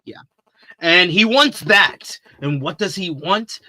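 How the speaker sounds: ordinary talk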